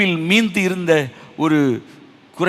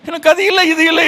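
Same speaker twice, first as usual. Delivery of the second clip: high-pitched mock wailing; higher, plaintive imitation of crying; acted tearful complaint; 14 semitones higher, 7 dB louder than usual. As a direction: whine it out as if weeping over what is missing. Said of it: "என்று அழுது கொண்டு இருக்கிற".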